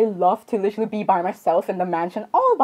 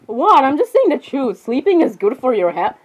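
ordinary delivery

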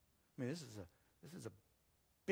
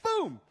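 loud, explosive boom; imitated punch boom; 12 dB louder than usual. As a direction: punch out the impact noise.

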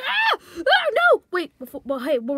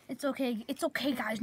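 ordinary talk

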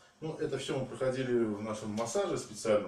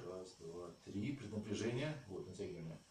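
soft counting; 3 semitones lower, 7 dB quieter than usual. Shown as a demonstration. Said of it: "вот раскачали".

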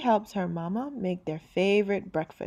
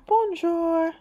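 put-on high, bright child's greeting; 10 semitones higher, 5 dB louder than usual. Girl answering the restaurant owner, high child voice. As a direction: say it back like a little girl.

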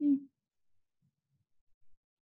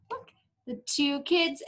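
ordinary delivery

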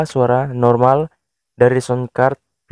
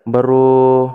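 ordinary delivery

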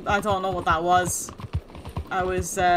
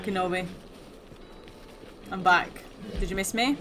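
ordinary talk

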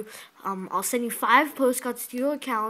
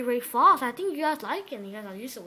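usual speaking voice

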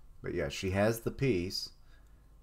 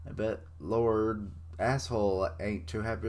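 ordinary talk, nothing marked

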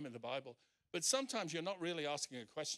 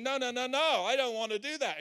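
loud, high, rapid-fire no's; protesting refusal; 7 semitones higher, 9 dB louder than usual. Retold or imitated. Preacher voicing Peter refusing God's new thing, loud protest. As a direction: protest loudly with raised pitch. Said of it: "And he's saying".

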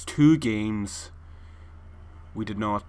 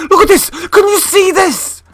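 exasperated yelling; 12 dB louder than usual. Real, not acted. Player showing friend the broken controller, exasperated yell. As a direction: yell it in disbelief.

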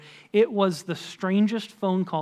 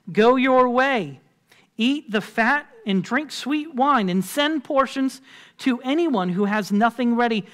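declaimed scripture; 3 semitones higher, 3 dB louder than usual. Acted. Leader sending the people to feast, declaimed scripture. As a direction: proclaim a festive command in measured phrases. Preacher reads scripture command aloud.

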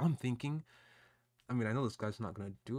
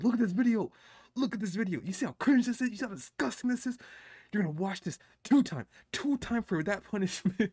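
high, loud mock telling-off; hammed-up parental lecture, pitched high; scolding parent; 7 semitones higher, 5 dB louder than usual. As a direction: lay down the punishment like an exasperated parent. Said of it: "I'm gonna traumatize with this video".

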